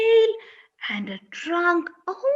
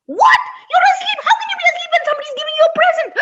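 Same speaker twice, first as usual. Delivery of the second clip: high loud astonished cry; fast, high, can't-believe-it; duckling shocked the cow sleeps, high and loud; high shocked disbelief; 13 semitones higher, 5 dB louder, much faster than usual. Acted.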